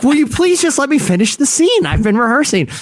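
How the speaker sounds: high exasperated plea; pleading actor. Retold or imitated. Actor begging the panel to let him finish, high. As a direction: beg them, high and exasperated. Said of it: "He's like".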